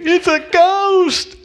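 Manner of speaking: high, drawn-out cry of alarm; terrified shout. Acted. Disciples spotting a figure on the water, terrified shout. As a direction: shriek it out in mock terror.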